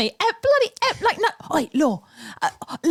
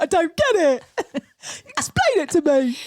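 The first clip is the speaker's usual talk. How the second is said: laughing confusion; 6 semitones higher than usual. Retold or imitated. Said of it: "you were like".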